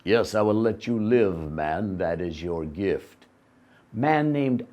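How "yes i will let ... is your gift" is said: low lion voice. Acted. Lion answers man, low voice.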